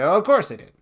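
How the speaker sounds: mock breezy cover-up. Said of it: "You say".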